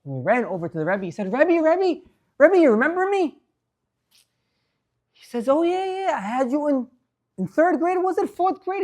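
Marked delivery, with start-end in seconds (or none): 1.3-3.2 eager greeting. Retold or imitated. Former student greets his teacher. 5.5-8.8 higher, lightly voiced old rebbe trying to remember; mild, higher recollecting voice; a hint of a musing old teacher. Old teacher tries to recall.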